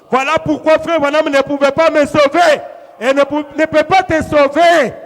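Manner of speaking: loud, rising sermon delivery; forceful preaching. Real, not acted. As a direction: preach it hard, building in volume and pitch.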